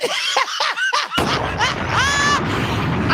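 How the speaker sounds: big shrieking burst of laughter. Real, not acted. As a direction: throw your head back and howl.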